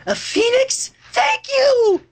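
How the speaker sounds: high thrilled thank you; excited squeal. Acted. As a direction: squeal with joy over the gift. Fluttershy squeals with delight.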